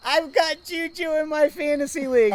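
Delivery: put-on high, loud and thrilled like a kid; excited little girl. Retelling a little girl's cheer over a touchdown, excited and high. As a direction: squeal it out like an eight-year-old fan. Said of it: "She's so excited".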